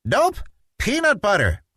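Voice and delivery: put-on high, insistent little boy; stubborn child insisting; insistent Petey. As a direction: plant your feet and insist like a kid. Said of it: "insisted Petey".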